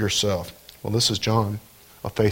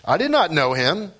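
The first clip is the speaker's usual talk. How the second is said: higher-pitched, declamatory Bible reading; scripture reading; 9 semitones higher than usual. Acted. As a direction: proclaim the passage to the congregation. Reads John's testimony from scripture.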